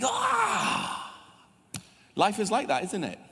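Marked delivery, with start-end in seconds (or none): 0.0-1.1 high mock cry of despair; a short, high wail of frustration; frustrated cry. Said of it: "I'm like".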